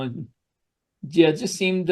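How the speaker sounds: ordinary delivery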